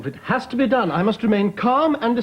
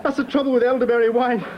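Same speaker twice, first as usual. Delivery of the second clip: higher, strained voice fighting back tears; choked up; 6 semitones higher than usual. Acted. Husband moved by loyal colleague, choking back tears. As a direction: try to hide that you're welling up. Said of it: "it's made his eyes water".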